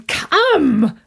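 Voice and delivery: eager urging. Acted. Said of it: "my uncle said".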